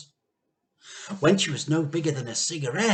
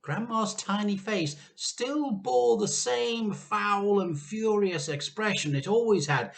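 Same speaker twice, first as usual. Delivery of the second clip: usual speaking voice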